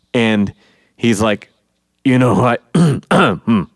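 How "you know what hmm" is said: acted choked-up start, clearing the throat; stall, clear your throat, then try the line; lump in throat. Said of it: "like, a lump in his throat".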